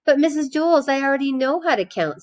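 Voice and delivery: put-on a little boy protesting to his teacher; whiny child Joe. A narrator's reading. Teacher reading to class, voicing a pleading boy. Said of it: "said Joe".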